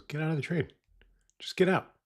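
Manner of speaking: retold blunt order, pitched well up; imitating an insistent wife; firm order. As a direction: tell them to get out, high-pitched.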